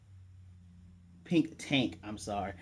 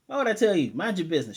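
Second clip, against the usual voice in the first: higher, louder comeback, lightly acted; mock indignant retort; 5 semitones higher, 5 dB louder, faster than usual. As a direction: imitate a boy telling someone off. Boy snaps back at nosy question.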